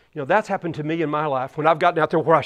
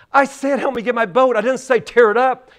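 put-on high, indignant child's complaint; high kid voice scolding the big boy; indignant little kid; 6 semitones higher, 3 dB louder than usual. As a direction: protest like an offended small boy.

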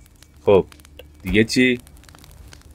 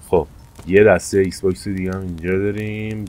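usual speaking voice

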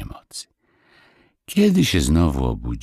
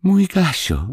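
high, louder call for her dog; 3 dB louder, slower than usual. A narrator's reading. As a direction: cry out her dog's name. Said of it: "zawołała Marysia".

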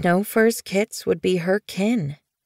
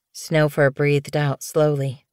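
plain reading voice

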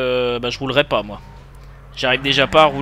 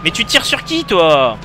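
raging gamer; 3 semitones higher than usual. Real, not acted. Shot at by gangsters.

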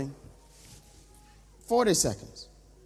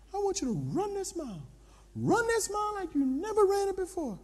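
high, intent put-on coach voice; trainer's voice; 10 semitones higher, 3 dB quieter than usual. Trainer urging the pastor to run like never before, high and controlled. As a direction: urge in a higher voice without shouting. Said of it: "He says".

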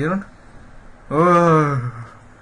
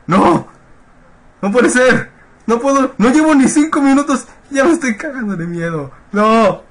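panicked yelling; 10 semitones higher, 5 dB louder than usual. Real, not acted. Panicking while playing horror game.

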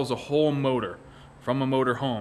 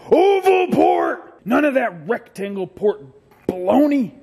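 hyped shout; 6 semitones higher, 5 dB louder than usual. Acted. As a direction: proclaim it like a battle cry. Hype engine parts.

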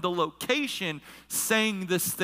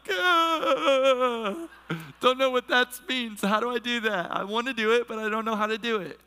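mock high-pitched wail; overwhelmed; 4 semitones higher than usual. Preacher acting out his panic at being told to obey God, overwhelmed. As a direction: cry out in comic helplessness.

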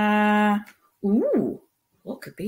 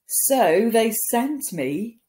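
ordinary delivery, nothing marked

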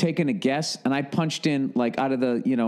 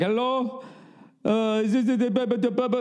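higher, hesitant call-center voice, stumbling over "the"; stammering scam caller; 8 semitones higher than usual. Retold or imitated. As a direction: play the unsure scammer on the line.

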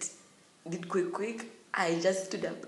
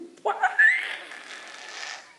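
very high, loud shriek; re-enacted scream; 10 dB louder than usual. Student recalling her caning, re-enacted scream. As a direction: let out a piercing mock scream. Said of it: "You guys, I scram".

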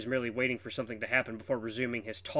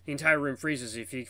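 ordinary delivery, nothing marked

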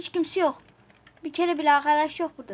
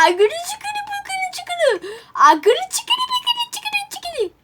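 very high squealed nonsense; goofy high-pitched babble; silly gibberish; much slower than usual. Pretend. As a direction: chant nonsense syllables in a squeal. Spouting nonsense syllables in play.

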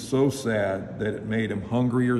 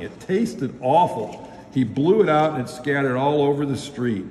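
usual speaking voice

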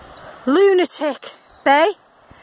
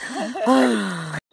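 low laugh tailing into a groan; winded laugh; 5 semitones lower, 5 dB quieter than usual. Real, not acted. Climber laughing at the next stage up, winded. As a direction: laugh helplessly, out of puff.

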